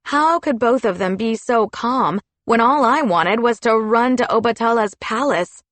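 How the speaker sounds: ordinary delivery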